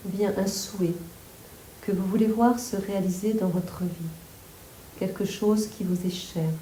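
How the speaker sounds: calm, unhurried yoga nidra guidance; guided meditation. Real, not acted. Yoga teacher guiding listeners to relax the whole body, guided meditation. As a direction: guide them through the body, phrase by phrase with long pauses.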